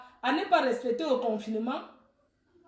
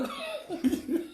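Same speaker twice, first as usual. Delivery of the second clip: soft, higher-pitched laugh; laughter; 4 semitones higher, 7 dB quieter than usual. Real, not acted. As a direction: trail off into soft high laughter. Laughs at own remark. (rire léger et aigu)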